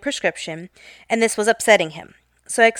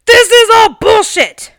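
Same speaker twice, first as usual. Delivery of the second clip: put-on much higher and louder, a cranky old man's courtroom outburst; angry old man outburst; 12 semitones higher, 8 dB louder than usual. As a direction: explode at the judge like a furious old man.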